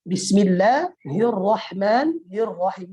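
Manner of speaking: drawn-out Quranic recitation; slow recitation. Shown as a demonstration. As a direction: recite it slowly and melodically. Ustaz recites basmalah slowly.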